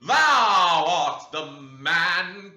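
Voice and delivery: loud, very slow, high proclamation; crying out. Acted. Preacher denouncing the dreamer before the chapel, loud slow cry. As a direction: pronounce it like a verdict. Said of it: "cried James".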